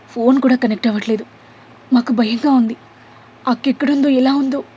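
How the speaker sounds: a touch of fear, rising pitch toward the end; fretting about her missing sister, voice climbing; scared and tearful. A narrator's reading. Sister tells Veeren she's afraid, near tears. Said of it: "అన్వికి దుఃఖం ముంచుకొచ్చింది".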